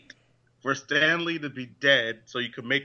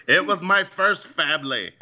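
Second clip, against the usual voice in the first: higher, louder bad impression through laughter; Stan Lee; 4 semitones higher, 5 dB louder than usual. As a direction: do a badly faked impression, half laughing. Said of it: "That's the worst Stan Lee impression ever".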